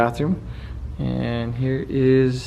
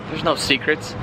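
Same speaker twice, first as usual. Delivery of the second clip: usual speaking voice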